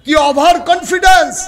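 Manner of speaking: loud, high pulpit cry; emphatic exclamation. Real, not acted. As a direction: proclaim it to the whole crowd. Preacher repeats line with force.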